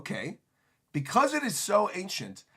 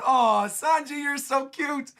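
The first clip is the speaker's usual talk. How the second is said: high, gushing giant-lady voice; fawning high coo over Sanji; giant ladies cooing; 8 semitones higher, 3 dB louder, slower than usual. Retold or imitated. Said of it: "the giant ladies picking him up going".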